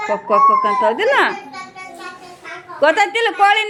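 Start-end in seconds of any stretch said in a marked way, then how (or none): 0.1-0.7 much higher pitched ಕೊಕ್ ಕೊಕ್ ಕೊಕ್ clucking like a hen. Shown as a demonstration. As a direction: cluck it high for the children. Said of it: "ಕೋಳಿ ಹೆಂಗ್ ಕೂಗ್ತದಪ್ಪ".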